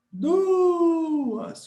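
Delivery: DUAS called high and loud, U stretched; shout technique. Shown as a demonstration. Teacher repeating DUAS with a held U for students, shout technique. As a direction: hold the U in DUAS, then drop onto the A. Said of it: "a técnica do grito".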